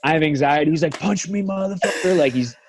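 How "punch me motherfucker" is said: imitated coach goading. Coach provoking a worn-out fighter, retold goading. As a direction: goad him like a pushy coach.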